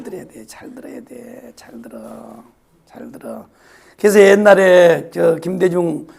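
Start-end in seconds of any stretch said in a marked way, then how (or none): 0.0-3.5 whisper. Real, not acted. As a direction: whisper it again and again, dying away. Repeated whispered exhortation.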